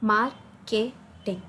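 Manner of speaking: "marketing" slowly split into three beats; syllable by syllable. Shown as a demonstration. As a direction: say "marketing" as mar, ke, ting with gaps. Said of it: "and pronounce the word".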